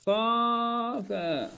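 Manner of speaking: خافا very slow and high, both aa held; long drawn-out vowels. Shown as a demonstration. Teacher models the dual command, drawn-out خافا. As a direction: stretch out each aa in خافا.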